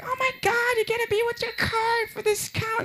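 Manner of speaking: high, squeaky mock freak-out; panicking store clerk. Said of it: "She starts freaking out once again".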